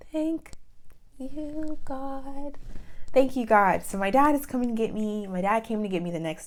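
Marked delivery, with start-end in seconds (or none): 0.1-2.6 high, hushed thanks to God; grateful prayer. Real, not acted. Thanking God that dad will come, high and hushed. 3.1-3.8 louder, emphatic thanks to God; firm thanks. Real, not acted. Thanking God again for dad coming, louder and firm. Repeats thanks more firmly.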